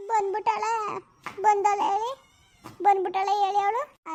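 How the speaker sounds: high voice breaking into sobs; crying. Acted. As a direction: sob out the words. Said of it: "ಸುಮ್ಕಿರು ಮಗ, ಅಳ್ಬೇಡ". (ಅಳುತ್ತಾ ಮಾತನಾಡುವುದು)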